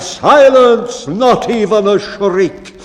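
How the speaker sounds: high, loud cry for silence; cried out. Acted. Bellman crying out to silence his crew, loud and high. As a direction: snap the crew into total silence. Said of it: "And the bellman cried".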